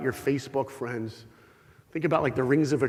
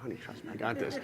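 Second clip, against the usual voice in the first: hushed reassurance; 5 semitones lower, 11 dB quieter, faster than usual. Retold or imitated. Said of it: "I'm like".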